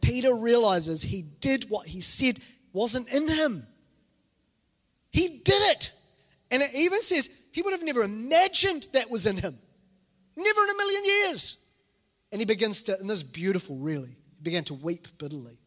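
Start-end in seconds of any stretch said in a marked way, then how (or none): 5.1-11.6 high, impassioned preaching; astonished, high-pitched proclamation; emphatic disbelief. Real, not acted. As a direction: drive home the shock, pitch soaring. Preacher stresses Peter's shocking fall.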